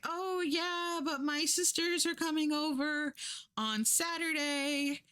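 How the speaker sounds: hammed-up politeness hiding the push to leave; fake-polite hosts. Hosts make excuse.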